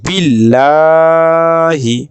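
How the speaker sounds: বিল্লাহি chanted slowly with a thin, light লা; light Quranic recitation. Shown as a demonstration. Teacher reciting the word for students, slow chant. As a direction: draw out বিল্লাহি, the লাম thin like ল-আকার. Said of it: "বাংলার ল আকারের মতো উচ্চারণটি হবে".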